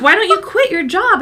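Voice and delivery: high, insistent retold challenge; mock heated urging; imitating arguing therapist. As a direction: press the question like an argument. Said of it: "screaming at each other, arguing".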